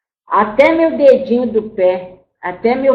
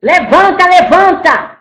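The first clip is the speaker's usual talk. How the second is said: higher, harsh shouted order; master's command; 6 semitones higher, 4 dB louder than usual. Retold or imitated. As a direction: bark it like the overseer with the whip. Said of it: "o homem branco já veio".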